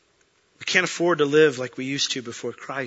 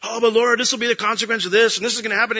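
higher, louder, quick protest; anxious pleading; 9 semitones higher, 7 dB louder, faster than usual. Retold or imitated. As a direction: plead your case in a rush. Wrestling with the Lord.

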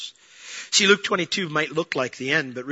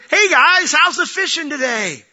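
high, loud friendly hail across the water; cheery call out; 12 semitones higher, 8 dB louder than usual. Retold or imitated. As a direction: call out to the boat. Jesus calls to disciples from beach.

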